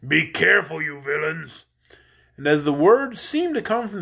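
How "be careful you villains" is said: lower, gruff goat voice; a cranky goat scolding the men. Acted. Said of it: "a gruff voice cried".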